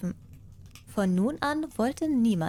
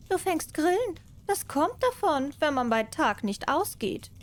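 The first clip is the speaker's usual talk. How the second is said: higher, teasing cat voice; mocking cat; 8 semitones higher than usual. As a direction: tease the mouse from above. Cat scolds the mouse.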